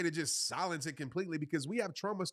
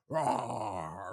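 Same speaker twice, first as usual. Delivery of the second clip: low mock monster roar; playful low beast roar; 9 semitones lower than usual. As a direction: growl like the scary furnace. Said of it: "the scary furnace is your thoughts".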